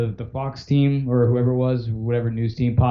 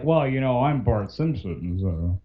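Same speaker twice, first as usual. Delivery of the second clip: smug, slow; 2 semitones lower, slower than usual. Retold or imitated. Imagined star showing off to fan, smug. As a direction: act like a self-important star. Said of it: "to be, like, a dick".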